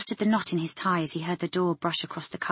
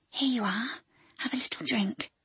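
whisper; 5 semitones higher, 5 dB quieter than usual. Acted. Offering water to dying woman.

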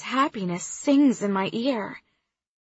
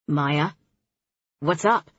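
worried; 5 semitones lower, slower than usual. Acted. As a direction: lower male voice, a touch worried. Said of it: "He sounds worried".

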